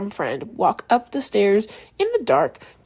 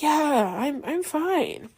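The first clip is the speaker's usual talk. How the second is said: higher, slow, unconvincing "I'm fine"; forced fine; 6 semitones higher, much slower than usual. Retold or imitated. Asked again if she's okay, a slow, high, hollow denial.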